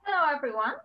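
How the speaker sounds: ordinary talk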